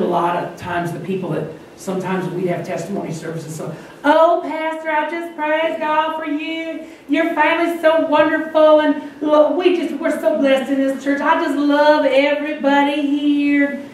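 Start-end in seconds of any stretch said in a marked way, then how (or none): 4.0-13.8 high, sweetly effusive church lady; drawn-out, sugary high-pitched praise; gushing church lady. Retold or imitated. Church lady gushing to her pastor in testimony service, high and syrupy. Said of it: "I see the same lady".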